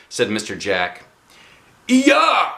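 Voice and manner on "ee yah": loud, high kiai shout; karate yell. Acted. Teacher demonstrating the scream, loud high kiai. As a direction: bark out a fighting cry. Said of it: "lots of people scream as a team".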